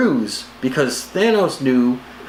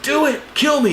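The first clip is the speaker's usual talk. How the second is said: much higher, urgent mock cry; mock Gamora plea; 13 semitones higher than usual. Gamora begging Star-Lord, a high mock plea. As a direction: beg for it like a desperate daughter. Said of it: "And she's like".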